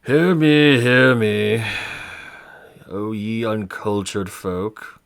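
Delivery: booming, slow proclamation; thundering prophet voice. Acted. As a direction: boom it out like a prophet from on high. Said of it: "this bow vibrate a bit loud".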